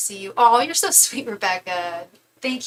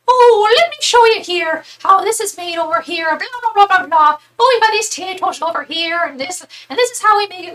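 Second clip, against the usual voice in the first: high, loud mock tour guide; hammed-up impression of a factory guide; old Irish lady; 10 semitones higher, 9 dB louder than usual. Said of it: "this old Irish lady".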